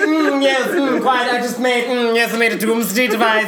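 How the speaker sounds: hammed-up self-satisfied villain with hums; Lex Luthor. Friend mocking Luthor's doomsday reveal, affected villain voice. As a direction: savor it like a parody villain. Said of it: "Lex Luthor's like".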